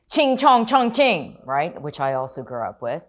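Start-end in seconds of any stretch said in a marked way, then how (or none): 0.1-1.4 high, loud, slow singsong jeer; mocking taunt. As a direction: sneer it out like a schoolyard taunt. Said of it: "Then I hear the".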